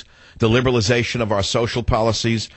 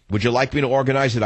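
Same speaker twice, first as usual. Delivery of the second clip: normal talking voice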